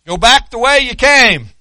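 the command shouted back to the congregation; preached loud. Acted. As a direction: hurl the command out to the whole church. Preacher repeats the command loudly.

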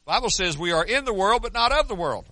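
ordinary delivery

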